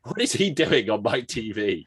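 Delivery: playfully affronted complaint; mock outrage. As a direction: protest in comic disbelief. Retells indignant reaction to advert.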